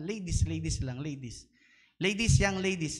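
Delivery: loud call across the room; loud call to the ladies. Real, not acted. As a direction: summon them loudly. Calling the ladies to line up.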